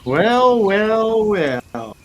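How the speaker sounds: high, loud, stretched-out "well" falling on the last; hammed-up gloating greeting; mock sinister well well well.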